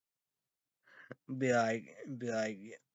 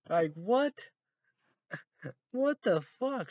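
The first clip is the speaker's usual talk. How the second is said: normal talking voice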